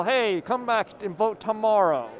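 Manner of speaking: ordinary delivery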